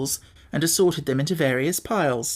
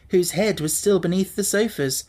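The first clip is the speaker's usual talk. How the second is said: usual speaking voice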